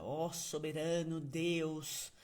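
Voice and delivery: slow, solemn, over-formal prayer voice; a mock lofty address to God; mock eloquent prayer. Shown as a demonstration. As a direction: pray like a pompous orator, drawing it out. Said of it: "com uma eloquência".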